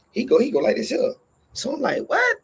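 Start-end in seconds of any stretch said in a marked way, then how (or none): 2.1-2.4 sudden very high squeaky "What?"; a startled, disbelieving yelp; shocked what. Retold or imitated. Slapped hand, shocked reaction.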